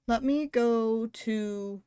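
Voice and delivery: usual speaking voice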